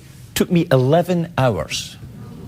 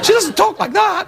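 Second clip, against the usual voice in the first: pitched way up, comic denial; mock high voice. Host jokingly denying his impression, high mock voice. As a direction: deny it in a squeaky put-on voice.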